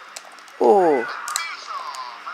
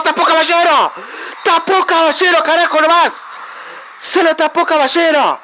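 higher, shouted joy at the save; excited shout; 5 semitones higher than usual. Real, not acted. Gamer celebrating his keeper's penalty save, excited shout. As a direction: yell the keeper's name in triumph.

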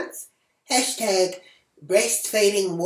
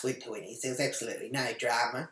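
normal talking voice

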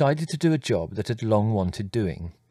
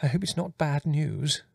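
quavering; 6 semitones higher than usual. A narrator's reading. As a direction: quaver nervously, pitched well up. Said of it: "Mother quavered".

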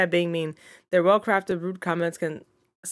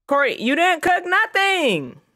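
high, louder, slow accusation; slow exasperated scold; 10 semitones higher, 5 dB louder, slower than usual. Real, not acted. Player scolding Corey for not cooking, slow exasperated scold. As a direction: lay it on her, fed up.

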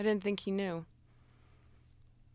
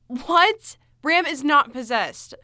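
loud, disbelieving outburst; incredulous denial; 10 dB louder, slower than usual. Acted. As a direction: protest loudly in disbelief. Denies boss is possessed.

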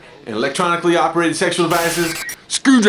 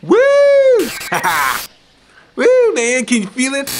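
high, loud manic whooping and laughter; carried away; 11 semitones higher, 4 dB louder than usual. Acted. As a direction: whoop wildly, swept up in your own excitement. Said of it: "Got carried away".

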